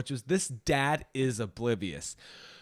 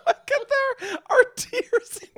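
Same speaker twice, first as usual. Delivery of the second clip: very high, squeaky real laughing speech; helpless laughter; 4 dB louder than usual. Real, not acted. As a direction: squeeze words out through laughter. Both laughing until they cry.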